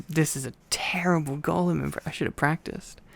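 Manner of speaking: ordinary delivery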